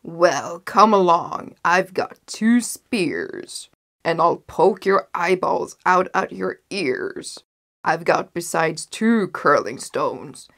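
loud, hoarse big goat challenge; ugly hoarse voice. A narrator's reading. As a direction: threaten back as the big rough goat. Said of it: "an ugly hoarse voice of his own".

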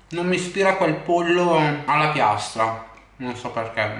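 talking with food in mouth; eating while speaking; while chewing. Real, not acted. Seen 0: Bites nugget near 1.6s, chews while talking until about 5.7s.

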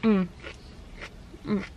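humming while chewing food; muffled through a mouthful; mouth full, chewing. Real, not acted. Seen 0: She bit the wrap earlier; cheeks full, chewing during the "mm"s.

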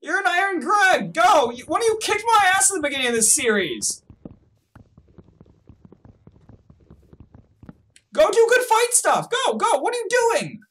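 very high, loud frantic yelling; exasperated screaming. Real, not acted. Player yelling at idle fighter Greg, exasperated screaming. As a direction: scream orders in outraged panic.

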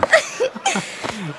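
put-on high drunken giggle; a woozy woman's giggle; giggling drunk woman; tipsy giggle.

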